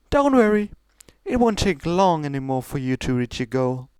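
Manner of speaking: high, regal princess voice pushing her sister; lofty royal sister, coaxing; Celestia's voice. Acted. Older sister pressing Luna to go on, high and regal.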